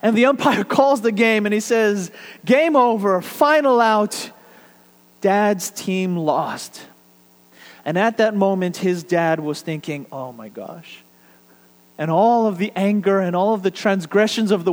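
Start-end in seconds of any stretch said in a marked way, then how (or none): 2.5-6.8 slow, drawn-out umpire's call. Retold or imitated. Umpire ends the game for the team, slow and drawn out. 10.1-11.0 soft, low inner groan of dismay; dismayed thought. Retold or imitated. Father sees his team lose, soft and low.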